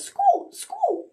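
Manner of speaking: very high squeaky toy voice; tiny high rubber-duck squeak. Acted. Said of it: "all he could say was".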